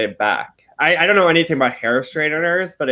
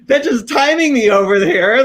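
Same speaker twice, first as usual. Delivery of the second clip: high and loud, laughing through the words; laughing disbelief; 8 semitones higher, 4 dB louder than usual. Retold or imitated. Telling friends about a border guard timing him, laughing high and loud. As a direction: crack up at being timed in the bathroom. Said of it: "I was like".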